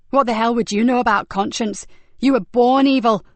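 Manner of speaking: furious outburst, rising loud; exploding in anger. Acted. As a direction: hurl it at her, enraged. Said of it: "exploded Nicky".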